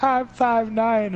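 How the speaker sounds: high, drawn-out mock quote; put-on high voice, dragging the words; mimicking her. Mimicking what she said.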